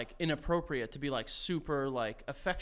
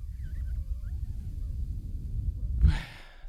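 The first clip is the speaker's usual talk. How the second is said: long relieved exhale. Real, not acted. Holding breath then releasing.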